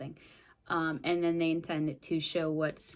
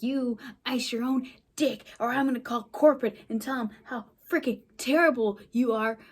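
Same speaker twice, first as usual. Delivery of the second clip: higher-pitched mock-angry rebuke; telling someone off; 5 semitones higher, 5 dB louder than usual. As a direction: imitate a manager laying into a colleague. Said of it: "the assistant manager tells off the other".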